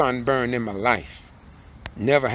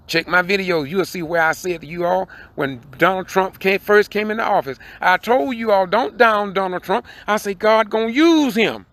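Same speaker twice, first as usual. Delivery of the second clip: louder, higher, rising to a fiery sermon shout; full-force preaching; 8 semitones higher, 6 dB louder than usual. Acted. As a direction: preach it hard to the congregation.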